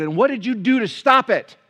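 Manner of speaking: indignant pastor firing back loud; retold confrontation. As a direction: press her hard with each question. Pastor retells confronting a lady.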